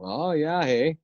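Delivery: put-on Minnesota accent. Joking about Fargo folk, playful Minnesota accent. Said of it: "that Minnesota accent".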